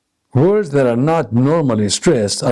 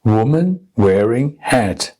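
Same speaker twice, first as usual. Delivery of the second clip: three spaced stresses on "Woman wearing hat"; one deliberate beat per word, "Woman" rising; rhythm drill. Shown as a demonstration. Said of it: "stressing only the content words".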